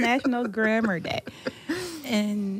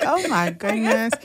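ordinary talk, nothing marked

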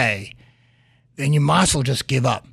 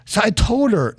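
ordinary delivery

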